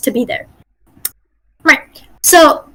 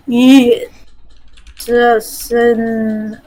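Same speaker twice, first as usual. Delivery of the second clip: exaggeratedly slow, with long gaps; really slow; 3 semitones lower than usual. Shown as a demonstration. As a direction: drag every word way out. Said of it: "if I go really slow".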